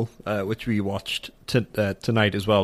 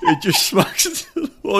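ordinary talk

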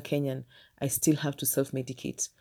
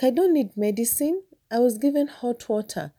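put-on high, matter-of-fact child; 8 semitones higher than usual. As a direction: say it like a confident little girl. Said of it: "I'm like".

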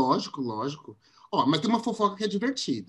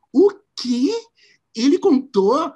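shocked; 7 semitones higher, 6 dB louder, slower than usual. Retold or imitated. Shocked at the friend's betrayal.

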